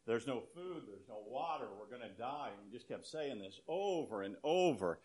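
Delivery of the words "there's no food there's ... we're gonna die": hushed, worried repeating to himself; a stranded man frets under his breath, much softer; agitated muttering. Retold or imitated. Stranded man repeating his fears, hushed and anxious. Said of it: "more and more worked up and agitated".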